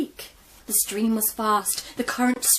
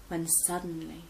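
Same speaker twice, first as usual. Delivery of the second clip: plain reading voice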